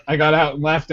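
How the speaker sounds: ordinary talk, nothing marked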